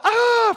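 high, loud mock scream; hammed-up "ah" of panic; freaked-out scream. Townsfolk freaking out at the warlock, mock scream. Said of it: "everybody's freaked out".

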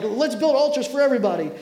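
a touch of panicked excitement; excited disciples. Retold or imitated. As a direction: pitch it up like startled followers. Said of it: "the disciples get all freaked out".

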